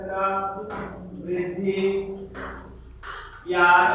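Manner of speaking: very slow, word by word; drawn-out dictation. Real, not acted. Teacher writing out a heading for students, drawn-out dictation. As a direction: stretch each word out, pausing between them.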